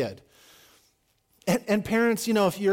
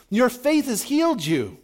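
calling back; 8 semitones higher, 4 dB louder than usual. Acted. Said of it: "he calls audibly back".

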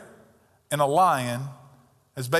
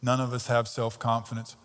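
usual speaking voice

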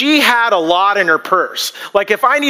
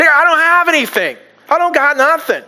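overdramatic widow; 8 semitones higher than usual. Retold or imitated. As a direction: ham up the widow's despair, lightly. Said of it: "that's just kinda over dramatic".

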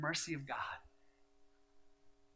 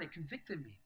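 ordinary talk

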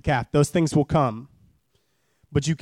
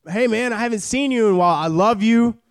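higher, warm check-in voice; friendly text read aloud; 6 semitones higher, 3 dB louder than usual. Retold or imitated. Friend texting a missed member, warmly. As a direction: reach out like a caring buddy. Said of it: "You'll get a text from Damon".

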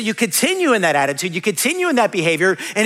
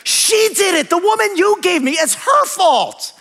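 high, blaming whine, drawn out at the end; childish blame in a high voice; whiny Adam; 8 semitones higher than usual. Retold or imitated. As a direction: play Adam pointing at Eve, high and petulant. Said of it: "And he's like".

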